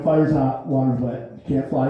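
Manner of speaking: put-on low, gruff old-timer reciting rules; old guy yelling rules. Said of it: "this old guy yells the rules".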